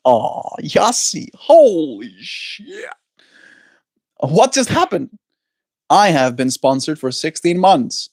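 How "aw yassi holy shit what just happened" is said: surprised. Real, not acted. Surprised by a sponsorship.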